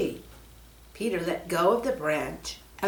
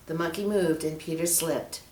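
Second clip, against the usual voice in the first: plain reading voice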